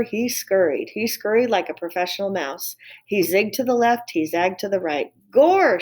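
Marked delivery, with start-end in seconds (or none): 5.3-5.8 higher and louder cry; shouted. Acted. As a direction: yell it as the mouse escapes. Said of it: "shouted Mig".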